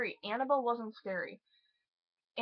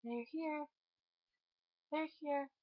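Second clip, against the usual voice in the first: softer, lifeless mock version of the line; half-hearted delivery; 7 semitones higher, 6 dB quieter than usual. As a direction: imitate a child saying it with no drama. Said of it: "She literally is just like".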